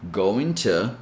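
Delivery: going to with a clear T, "going tu"; full going to; careful going to. Shown as a demonstration. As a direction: say "going to" with a crisp T. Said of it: "So you can say".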